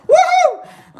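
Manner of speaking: very high, loud whoop; celebratory woohoo. Real, not acted. Host celebrates a day free of accents, high whoop.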